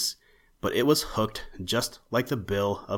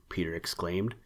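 plain reading voice

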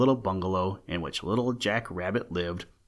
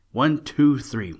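slow, louder call into the telephone; phone shout; 4 semitones higher, 7 dB louder, slower than usual. A narrator's reading. The old rabbit shouting his number into the phone, slow and loud.

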